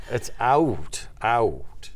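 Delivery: out with a drawn-out ow glide; Canadian diphthong out. Shown as a demonstration. Showing how Canadians really say it, out with a long glide. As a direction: stretch the diphthong in out. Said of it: "is it called a diphthong?".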